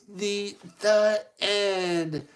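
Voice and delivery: slow, loud, high "the end"; grand story ending. Acted. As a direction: announce it with drawn-out flourish.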